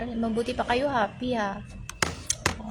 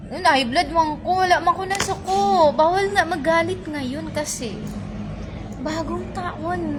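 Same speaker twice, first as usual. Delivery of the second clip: worked-up protest; 6 semitones higher, 5 dB louder than usual. Real, not acted. Denying being angry, worked up.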